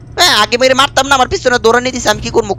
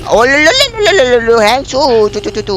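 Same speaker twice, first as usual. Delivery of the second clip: playful cooing; 2 semitones higher than usual. Pretend. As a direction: coax the monster with silly coos. Cooing at Mommy Long Legs. (পোষা প্রাণী ডাকার মতো উঁচু আদুরে ডাক)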